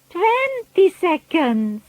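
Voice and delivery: high, slow witch cry. A narrator's reading. Grand High Witch crying the countdown to the audience. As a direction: call out the countdown triumphantly. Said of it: "cried the Grand High Witch".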